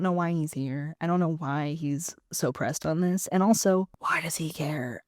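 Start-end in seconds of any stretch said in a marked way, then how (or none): 4.0-5.0 whispered aside. Real, not acted. Whispered aside mid-rant.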